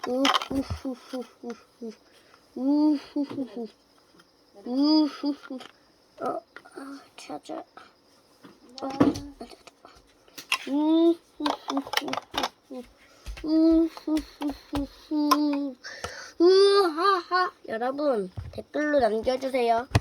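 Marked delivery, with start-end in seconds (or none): 0.1-3.7 slow creepy ghost laugh. Pretend. 10.7-17.5 slow creepy laugh building. Pretend.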